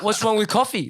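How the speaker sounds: high, loud mock-outraged question; indignant outrage. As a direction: demand it, retelling your indignation. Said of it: "I was so mad in that moment".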